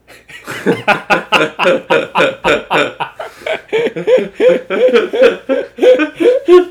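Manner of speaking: loud, high burst of laughter fading out; really cracking up at the punchline; big laugh. Real, not acted. Laughing hard at her own friend's punchline.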